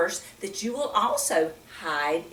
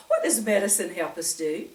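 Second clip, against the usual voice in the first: ordinary talk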